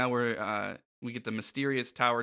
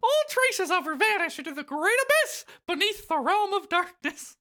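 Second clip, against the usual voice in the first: mock-grand falsetto mouse, louder; Mickey; 4 dB louder than usual. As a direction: declaim doom in a high mouse voice. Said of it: "Mickey replies".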